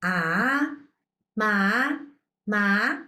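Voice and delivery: ma rising in pitch; ah and ma sliding upward; second tone. Shown as a demonstration. Teacher demonstrating second tone on ma. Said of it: "The second tone".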